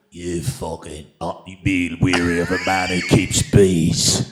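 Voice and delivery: low, slow tough-guy growl; menacing action-hero warning, lightly done; Jason Statham impression. Guest voicing the action star with a low slow menace. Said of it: "are you Jason Statham".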